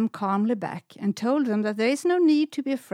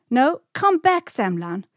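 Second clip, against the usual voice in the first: loud high call after a friend running off; 5 semitones higher, 7 dB louder than usual. Acted. Stella calls after Semlan as she runs down the beach, loud call. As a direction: shout to stop her leaving.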